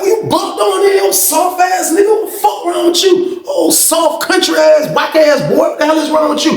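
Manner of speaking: high, heated shout at a rival; retold angry yell. Narrator yelling at the inmate who accused him.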